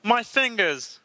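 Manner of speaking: high frog puppet voice; Kermit the Frog. Acted.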